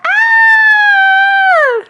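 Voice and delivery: piercing very high loud scream; shrill scream. A narrator's reading. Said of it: "The lady yelled".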